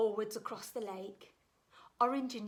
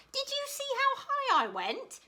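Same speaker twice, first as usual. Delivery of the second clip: very high and louder; thrilled; 7 dB louder than usual. Acted. Gooseberry boasting to Hare, high thrilled voice. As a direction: bubble over with pride. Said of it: "Gooseberry laughed".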